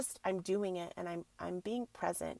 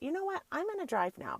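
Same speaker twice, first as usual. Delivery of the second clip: sudden resolve; 9 semitones higher than usual. Retold or imitated. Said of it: "And I thought".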